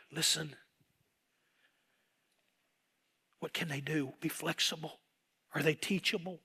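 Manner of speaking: hushed, gentle voice of God; hushed fatherly counsel; quiet counsel. Retold or imitated. God speaks quietly to preacher.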